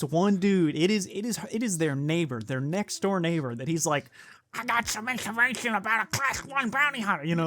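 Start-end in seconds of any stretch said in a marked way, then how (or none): 4.5-7.3 high, odd alien voice. Retold or imitated. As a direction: put on a strange alien voice. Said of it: "that's my alien voice".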